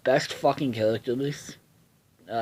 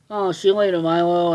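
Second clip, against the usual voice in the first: high, loud teasing send-off; mocking schoolkids; 6 semitones higher, 6 dB louder than usual. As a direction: taunt him like kids on the bus. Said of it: "and they're like".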